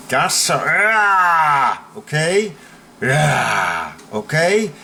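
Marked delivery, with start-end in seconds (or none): 0.7-1.9 long drawn-out growling roar; demonstrated growl at a bully; bear growl. Shown as a demonstration. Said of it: "the bear growls". 3.0-4.0 short growling roar; demonstrated growl; bear growl. Shown as a demonstration. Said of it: "the bear growls".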